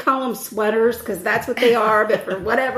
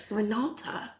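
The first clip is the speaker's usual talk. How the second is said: British accent; 3 semitones lower, 12 dB quieter, slower than usual. Shown as a demonstration. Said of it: "I'm saying it in a British accent".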